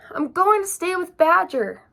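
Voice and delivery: loud call while running on; crying out. A narrator's reading. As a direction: shout it back over the wind. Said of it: "he cried".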